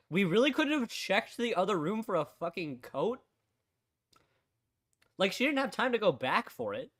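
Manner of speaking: loud, slow exasperated complaint; annoyed rant. Real, not acted. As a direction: vent at the game, loudly. Ranting at the game.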